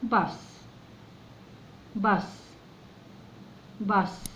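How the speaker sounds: باص with a light unpressed b; b in باص kept soft and unaspirated. Shown as a demonstration. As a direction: say باص without pushing on the b. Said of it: "ما ندوس على البي".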